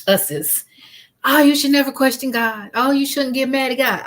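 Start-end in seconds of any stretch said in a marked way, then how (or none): 1.2-4.0 higher, mock-preachy scolding; mocking what people say. Grief counselor mimicking people who scold, mock-preachy. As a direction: parrot the pious advice with gentle mockery. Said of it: "in addition to people saying".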